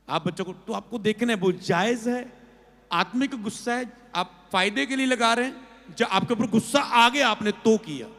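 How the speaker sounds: loud, driving sermon voice; fiery preaching. Acted. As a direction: preach it hard to the congregation. Preaching at full force. (जोश से ऊँची आवाज़ में प्रवचन)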